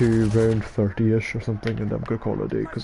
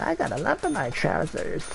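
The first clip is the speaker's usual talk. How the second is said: high, soft put-on woman's voice; mock female voice, pitched up; imitated woman; 6 semitones higher, 5 dB quieter than usual. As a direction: mimic her in a high, quieter voice. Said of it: "She's like".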